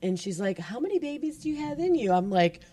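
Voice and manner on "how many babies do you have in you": put-on high, quick child's question; mock little-kid voice; imitated curious kid. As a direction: pipe it up fast like a nosy kid. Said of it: "the kid came up, and she's like".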